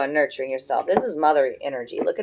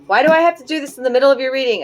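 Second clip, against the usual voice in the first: higher, louder, fast exasperated rant; frustrated complaint, rushing, much higher and louder; exasperated outburst; 8 semitones higher, 7 dB louder, much faster than usual. Real, not acted. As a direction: blurt it out, fed up with the smoke. Copal won't go out.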